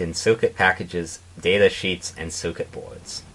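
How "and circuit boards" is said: ordinary delivery, nothing marked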